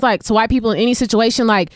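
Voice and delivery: ordinary delivery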